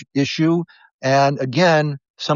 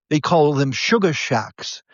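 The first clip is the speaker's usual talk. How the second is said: ordinary talk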